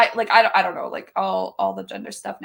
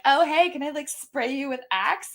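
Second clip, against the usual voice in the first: mock salesy offer; 5 semitones higher than usual. Pitching Axe to a boyfriend.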